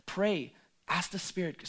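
usual speaking voice

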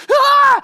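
sudden high yelp of fright; startled scream. Retold or imitated. Disciple in the boat screams in fright. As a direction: cry out in mock terror at the shape. Disciples see figure on water.

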